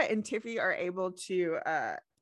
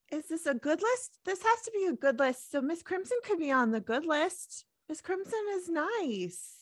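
excited; 9 semitones higher than usual. Acted. Excited gossip about list.

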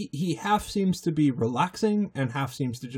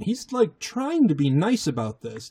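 ordinary delivery

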